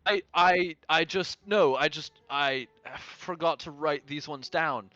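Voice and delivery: high, loud, halting excuse; flustered defensiveness. Acted. Cole asked if he forgot fighting creatures, flustered. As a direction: stumble defensively through an excuse. Character challenged about forgetting creatures.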